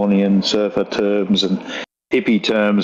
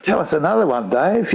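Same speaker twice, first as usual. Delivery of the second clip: higher-pitched eager request; lightly acted, eager for more; retold delight; 7 semitones higher than usual. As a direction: imitate a mate egging you on. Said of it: "it liked it".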